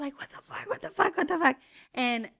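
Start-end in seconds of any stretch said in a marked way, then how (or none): 0.2-0.7 low stunned murmur; hushed panic. Retold or imitated. Mom panicking as baby turns purple. 0.7-1.6 high, loud panicked outburst. Retold or imitated. Mom panicking as baby turns purple.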